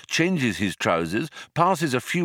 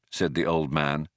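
plain reading voice

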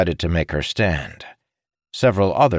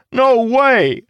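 much higher, louder flat refusal; 7 dB louder than usual. A narrator's reading. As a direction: shout it down, no arguing. Jacob refuses in a quarrel.

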